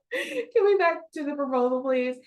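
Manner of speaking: high-pitched, laughing retold appeal; laughing plea. Her asking two arguing men to return to the proposal, laughing. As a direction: plead through a laugh to get back to work.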